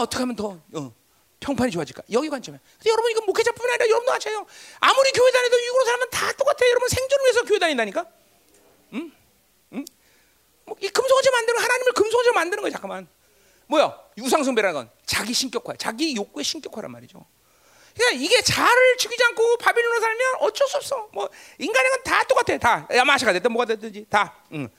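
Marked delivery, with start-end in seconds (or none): 2.8-12.7 high, loud pulpit delivery; raised-voice sermon teaching; forceful preaching. Real, not acted. 17.9-22.6 high, loud pulpit delivery; raised-voice sermon teaching; forceful preaching. Real, not acted.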